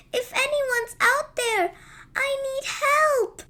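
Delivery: high, pleading little voice; frightened tiny fairy. Acted. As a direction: beg for help, small and scared. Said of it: "a tiny voice calling for help".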